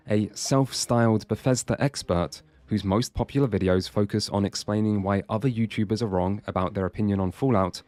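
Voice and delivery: ordinary talk, nothing marked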